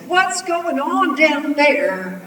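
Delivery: high, loud, slow demand; calling down. A narrator's reading. Grandma demanding answers from up the tree, calling down. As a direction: holler down from a height.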